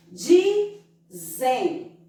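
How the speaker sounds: "Dizem" in two separate syllables, Di then nasal zem; "Dizem" slowly sounded out; nasal syllable demo. Shown as a demonstration. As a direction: pause between Di and zem in "Dizem". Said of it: "logo dá um som nasal".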